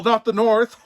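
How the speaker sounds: ordinary delivery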